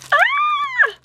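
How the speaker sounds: very high squeal of joy; high happy shriek; delighted squeal. Real, not acted.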